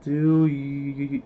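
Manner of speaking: drawn-out word breaking into repeats; stuttering. Real, not acted. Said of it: "can't even say the word".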